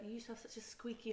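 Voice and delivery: ordinary talk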